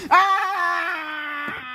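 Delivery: high drawn-out scream; long wail. Acted. Serpent reacts to God's curse, long high wail. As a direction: wail in dismay as you're cursed.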